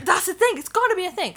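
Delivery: high, loud eager outburst; excited exclaim. Retold or imitated. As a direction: blurt it out in excitement. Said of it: "I was like".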